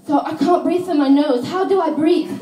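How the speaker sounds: high, loud puzzled tourist voice; mocking a clueless tourist. Comic mimicking a snorkeller asking the instructor, high and loud. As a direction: play the tourist baffled by the mask. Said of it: "they turn to me and they go".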